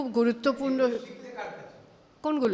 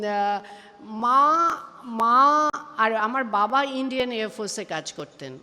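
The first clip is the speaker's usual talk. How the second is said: ordinary talk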